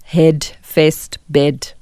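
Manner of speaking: "head, fest, bed" with a short open e in place of er; substituting e for er. Shown as a demonstration. Coach shows the e substitution for er to listeners, demonstrating. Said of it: "We're going to substitute that with e".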